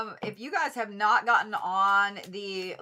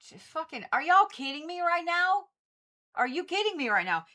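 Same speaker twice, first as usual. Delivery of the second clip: exasperated disbelief; 9 semitones higher than usual. Real, not acted. To the Aquarius cards, high exasperated disbelief. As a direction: throw up her hands at the cards.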